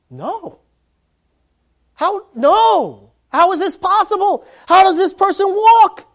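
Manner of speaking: rising to a loud, high cry of disbelief; outraged protest. Pretend. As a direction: demand it in shock and anger.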